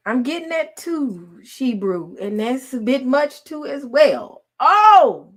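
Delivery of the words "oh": startled. Real, not acted. Sudden exclamation.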